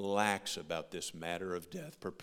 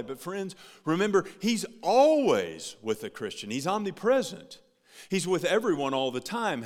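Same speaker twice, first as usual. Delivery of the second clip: louder, higher preacher's call; raised pulpit voice, emphatic; preaching at full force; 8 semitones higher, 8 dB louder than usual. Acted. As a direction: drive it home to the congregation.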